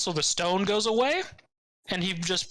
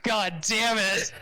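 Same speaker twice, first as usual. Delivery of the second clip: laughing disgust; 4 semitones higher, much slower than usual. Retold or imitated. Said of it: "And I'm just like".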